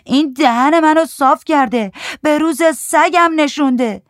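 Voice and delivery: high, loud tearful wail; sobbing. A narrator's reading. Weeping husband complains about his wife, sobbing. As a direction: wail the complaint through sobs. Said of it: "با حق حق گفت".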